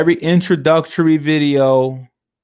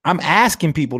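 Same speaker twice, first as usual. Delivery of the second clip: usual speaking voice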